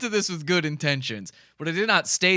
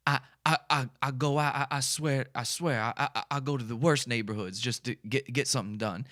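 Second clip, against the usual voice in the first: quiet stammering Chris impression, softer; imitating Chris; 3 semitones lower, 4 dB quieter than usual. Vlogger quietly mimics Chris stammering about bad neighborhoods. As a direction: mumble and stutter like him. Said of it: "exactly what he said in that first video".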